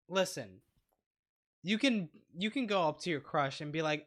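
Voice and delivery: normal talking voice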